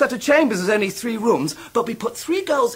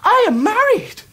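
excited; 11 semitones higher, 5 dB louder than usual. Acted. Traddles announcing his marriage.

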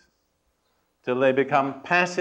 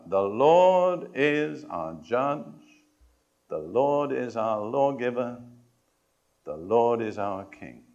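slow, word by word, like taking an oath; slow solemn recital; 3 semitones lower, slower than usual. Shown as a demonstration. Preacher leading the congregation in a vow, slow and solemn. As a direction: lead it slowly and deliberately. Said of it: "All right".

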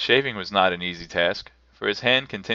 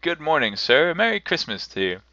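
higher-pitched cheery greeting; lightly voiced, good-humoured passer-by; good-humoured fellows; 7 semitones higher than usual. A narrator's reading. Passers-by greet Scrooge in the street, good-humoured. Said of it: "three or four good-humoured fellows said".

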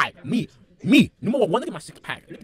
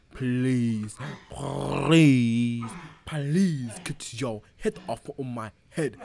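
begging; 8 dB louder, much slower than usual. Acted. Begging father to move.

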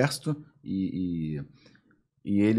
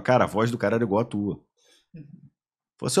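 ordinary delivery

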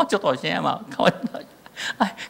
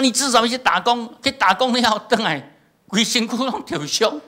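raised, much higher scolding voice; mock scolding father; 12 semitones higher, 3 dB louder than usual. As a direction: scold the son in a high, raised voice. Pastor scolding son, retold.

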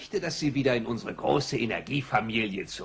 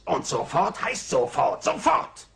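louder on the final Sofort!; angry command; 4 semitones higher, 5 dB louder than usual. Acted. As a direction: bark the final order like an impatient boss. Burns insists on immediate obedience. (wütender Befehl, zum Schluss lauter)

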